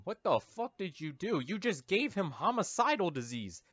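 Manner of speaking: normal talking voice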